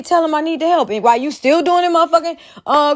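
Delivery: normal talking voice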